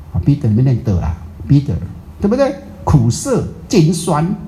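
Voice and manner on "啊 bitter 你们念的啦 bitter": ordinary delivery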